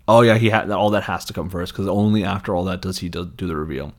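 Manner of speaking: normal talking voice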